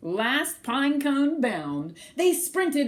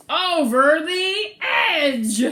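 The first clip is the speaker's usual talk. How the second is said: slow, loud, higher; dramatic exclamation; 6 semitones higher, 8 dB louder, much slower than usual. A narrator's reading. Reader exclaiming as the squirrels fall. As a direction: draw it out with alarm.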